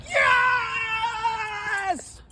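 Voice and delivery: very high, long-held scream; re-enacted scream of pure joy; ecstatic scream. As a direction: scream the word and stretch it right out.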